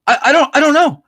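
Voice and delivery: high, stammering panicked voice; mock-frantic high stammer; flustered wife. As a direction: stumble over it like the frightened wife.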